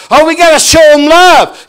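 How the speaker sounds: high, very loud mock objection; mocking shout. Preacher mimicking churchgoers who excuse sin, loud mocking cry. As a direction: belt out the excuse in mockery. Mocks lenient churchgoers mid-sermon.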